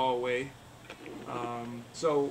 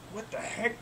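higher, hushed puzzlement; puzzled man; 7 semitones higher, 5 dB quieter than usual. Acted. Man feeling an unexpected bump, puzzled. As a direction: wonder aloud in a raised pitch. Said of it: "He feels a bump, and he looks".

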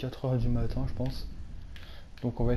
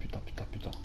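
voiceless, much softer swearing; frightened whisper; 10 dB quieter, faster than usual. Real, not acted. Whispering curses after hearing a noise in the cellar. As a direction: hiss the curses under your breath.

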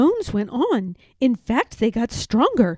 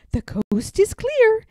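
put-on higher child's voice, conspiratorial; little girl Madeline; 12 semitones higher than usual. A narrator's reading. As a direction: signal that the coast is clear.

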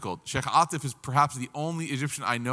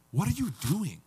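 higher-pitched incredulous scolding; mildly scandalized older sibling telling you off; upset sibling; 5 semitones higher, 3 dB quieter than usual. Retold or imitated. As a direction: imitate a sibling's disbelief. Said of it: "the people who were the most upset were the siblings".

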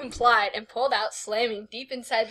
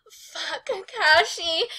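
acted moan; 6 semitones higher, slower than usual. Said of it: "grinning over here at my voice acting".

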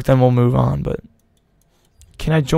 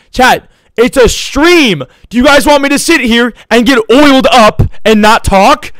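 exasperated shout; 8 semitones higher, 4 dB louder than usual. Real, not acted. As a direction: vent loudly at the audience. Exasperated rant at chat.

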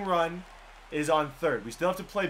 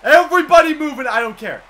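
high, loud urgent commands to runners; frantic shouting; 6 semitones higher, 13 dB louder than usual. Real, not acted. Gamer sending all the runners home, frantic excited shouting. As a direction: yell them home at full voice. Yelling at runners during play.

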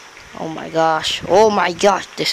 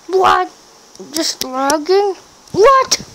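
very high, incredulous squawk; high-pitched outraged questioning; shocked disbelief; 11 semitones higher, 5 dB louder, slower than usual. Real, not acted. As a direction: squeal in exasperated disbelief. Game starts lagging.

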